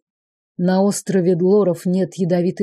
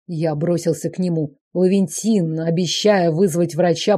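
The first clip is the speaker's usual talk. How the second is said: ordinary talk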